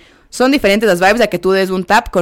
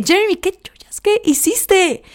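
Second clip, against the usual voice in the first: shocked outrage; 11 semitones higher, slower than usual. Retold or imitated. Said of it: "Y yo como que".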